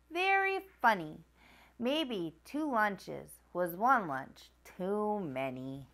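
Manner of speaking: lively picture-book read-aloud voice; reading to children. A narrator's reading. Narrator reading Fancy Nancy to young viewers, animated read-aloud. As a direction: read it like bedtime story time for kids.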